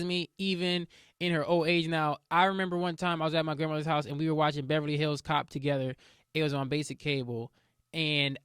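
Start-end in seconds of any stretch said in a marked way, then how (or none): none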